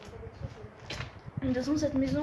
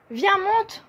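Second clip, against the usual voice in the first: calling out; 7 semitones higher than usual. Real, not acted. Calling friend to climb.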